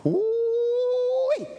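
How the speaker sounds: skeptical voice. Retold or imitated. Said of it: "first thing I heard".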